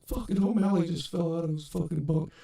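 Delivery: high, strained imitation of a cellmate; mock-astonished high voice; retold incredulous reaction. Retold cellmate reporting the fall.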